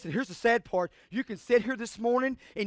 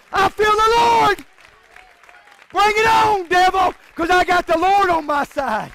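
very high, loud holler; full-force preaching; 8 semitones higher, 8 dB louder than usual. Real, not acted. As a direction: defy the devil at the top of your voice.

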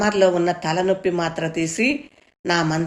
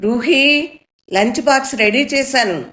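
higher and louder, calling across the house; mother calling from kitchen; 7 semitones higher, 4 dB louder than usual. A narrator's reading. Mother calls daughter from the kitchen, raised calling voice. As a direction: call out to your daughter from the next room. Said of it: "వంటింట్లోంచి అమ్మ గొంతు వినపడింది".

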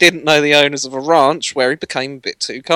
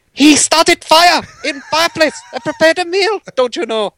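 much higher, put-on Canadian lawyer voice; mock Canadian accent; 13 semitones higher, 3 dB louder than usual. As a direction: play the Nova Scotia lawyer for laughs. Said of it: "you're gonna want me to do this".

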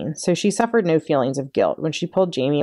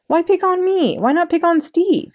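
put-on boy's voice, pitched high and a bit louder; high protesting boy; 13 semitones higher, 4 dB louder than usual. A narrator's reading. Little brother protesting to his sister, high and loud. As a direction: squeak out a protest like a younger brother.

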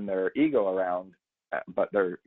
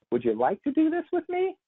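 far higher, squeaky unsure question; uncertain upswing. Retold or imitated. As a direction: sound needy and unsure. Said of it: "question and uncertainty".

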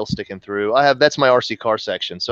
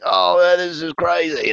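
higher-pitched mock English accent; attempted English accent; 6 semitones higher than usual. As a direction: imitate excited British guys in an English accent. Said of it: "whatever in English accent".

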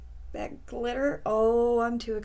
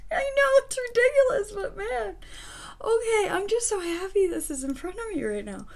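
overjoyed; 10 semitones higher, 4 dB louder than usual. Real, not acted. Overjoyed at the glitter.

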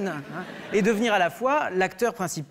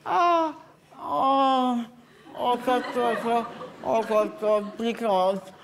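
high hen oohs settling down; a chicken preening itself; mock hen crooning slowly; chicken exclaiming; 4 semitones higher, much slower than usual.

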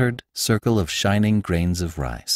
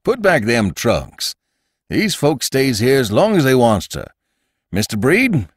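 gruff command; 4 semitones higher, 4 dB louder than usual. A narrator's reading. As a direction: lay down the law kindly but firm. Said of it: "commanded Mister Jacobus".

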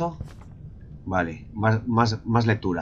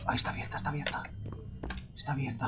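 tense whisper; 3 semitones higher, 12 dB quieter, much faster than usual. Real, not acted. Sneaking through door in game.

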